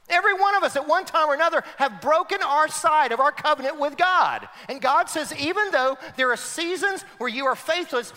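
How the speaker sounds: high, loud preacher's proclamation, slowing at the end; full-force preaching. Acted. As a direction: proclaim God's promise to the crowd. Preaching God's reply.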